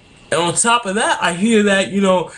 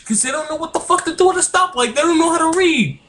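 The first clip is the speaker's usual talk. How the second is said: high, fast exasperated jab; exasperated rant; 12 semitones higher, faster than usual. Real, not acted. Mocking drivers who cannot read stoplights, high exasperated rant. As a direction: fire it off fast and high, fed up. Ranting about uneducated drivers.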